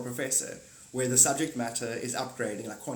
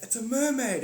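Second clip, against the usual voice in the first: passerby; 9 semitones higher, 4 dB louder than usual. Retold or imitated. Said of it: "and you're like".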